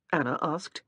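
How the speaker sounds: ordinary delivery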